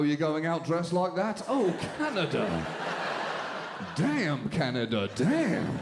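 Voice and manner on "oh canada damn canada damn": mock moan. Anthem parodied as orgasmic.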